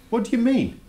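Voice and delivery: high startled what do you mean; surprised. Real, not acted. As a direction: genuinely caught off guard. Boy puzzled by teacher's note.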